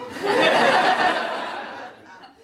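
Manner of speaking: high, loud burst of unvoiced laughter. Real, not acted. Storyteller laughing at the courtiers' reaction, loud and high. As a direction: crack up at the joke. Narrator laughs at own joke.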